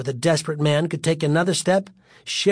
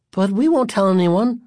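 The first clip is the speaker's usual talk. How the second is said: pleading, pitched up; rising plea; 4 semitones higher than usual. A narrator's reading. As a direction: let it climb into a plea. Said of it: "his voice rising until the words became a plea".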